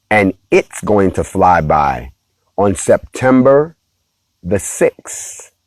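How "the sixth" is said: ordinary talk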